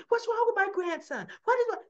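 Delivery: high, loud alarmed outburst; imitated frantic yell. Heather demanding news of her grandson, imitated frantic yell. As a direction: cry out in mock panic, pitch shooting up.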